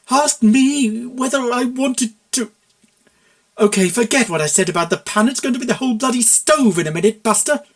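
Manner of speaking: seethe then threaten; outraged. Acted. Mayor threatening Buck with the stove, outraged.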